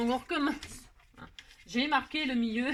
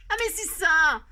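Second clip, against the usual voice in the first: exclamation surprise; 6 semitones higher, 9 dB louder, slower than usual. Real, not acted. Se rend compte de son erreur.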